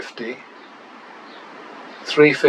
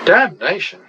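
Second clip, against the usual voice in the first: exasperated; 7 semitones higher than usual. Real, not acted. Another losing hand.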